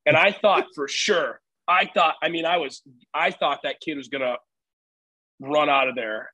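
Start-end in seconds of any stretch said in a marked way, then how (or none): none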